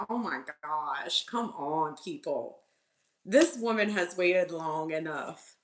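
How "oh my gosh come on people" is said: softer, lower sigh of impatience; quiet exasperation. Retold or imitated. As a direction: mutter it under your breath, fed up. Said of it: "and I'm like".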